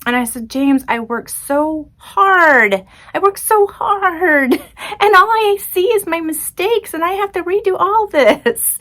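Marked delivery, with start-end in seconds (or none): 0.5-2.9 higher, very slow, strained plea; pleading frustration. Retold or imitated. Retold breakdown to husband. 3.1-8.8 much higher, tearful, breaking voice; crying frustration. Retold or imitated. Retold breakdown to husband.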